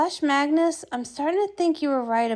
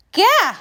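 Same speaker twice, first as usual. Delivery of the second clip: sudden sharp cry of fright, louder and higher; startled yelp; 5 semitones higher, 5 dB louder than usual. Acted. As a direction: jolt back with a yelp. Startled by moving plant.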